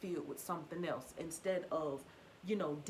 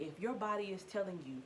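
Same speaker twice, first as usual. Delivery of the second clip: ordinary talk, nothing marked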